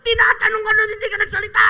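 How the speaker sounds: loud, high, exasperated yell; scolding shout. Acted. Preacher scolding members who stayed silent, loud and high. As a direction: shout it at them, fed up.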